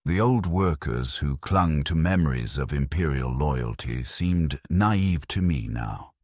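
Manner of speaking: ordinary delivery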